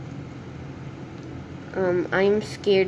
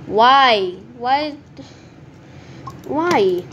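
ordinary talk, nothing marked

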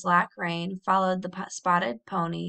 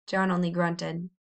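ordinary delivery, nothing marked

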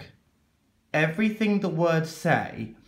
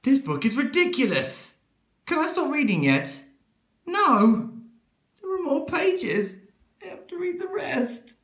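much higher, whining protest; a reader whining in despair; 11 semitones higher than usual. Acted. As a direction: whine like a trapped grown-up.

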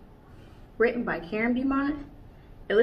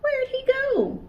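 much higher, wondering; puzzled searching; 5 dB louder, slower than usual. Acted. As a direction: look around, puzzled. Searching for hidden monkey.

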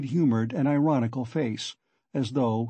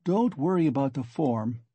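ordinary delivery

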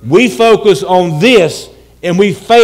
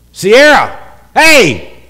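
loud, higher call for attention; 4 semitones higher, 7 dB louder, much slower than usual. Acted. Preacher calling Sierra to make her look, calling out. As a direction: call sharply across a room.

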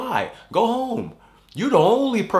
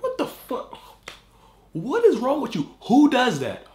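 pissed off; 10 semitones higher than usual. Real, not acted. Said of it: "my bear is pissed off right now".

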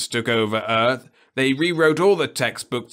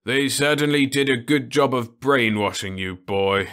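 sighing; 5 semitones higher, 6 dB louder than usual. Acted. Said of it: "Osmond sighed".

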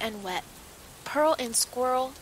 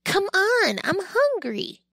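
hungry pup; 9 semitones higher, 4 dB louder than usual. Acted. Dog character begging for food.

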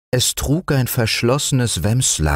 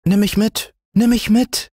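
put-on very high, urgent child plea; pleading little boy, repeating eagerly; excited begging. A narrator's reading. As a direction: beg to come along, all aglow. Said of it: "bettelte Fritz mit glühenden Wangen".

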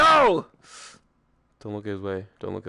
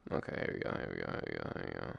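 hushed whisper; 18 dB quieter, much faster than usual. Real, not acted. Nervous hush before VR drop.

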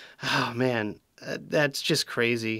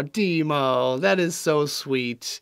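normal talking voice

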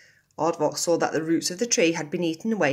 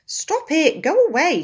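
high, loud yell at the noise; furious shout; 12 semitones higher, 12 dB louder than usual. A narrator's reading. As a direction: roar him away from the tree. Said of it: "he shouted".

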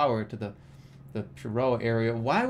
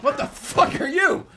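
high, loud, drawn-out disbelief; shocked, high-pitched outburst; startled yell; 7 dB louder than usual. Real, not acted. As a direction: blurt it loud and high, half laughing. Startled by something in the game.

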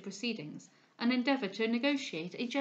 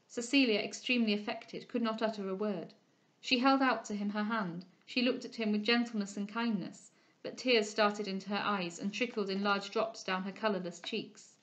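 ordinary talk, nothing marked

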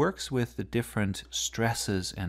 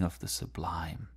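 hushed awe; 4 semitones lower, 7 dB quieter than usual. Acted. Awe about the sublime.

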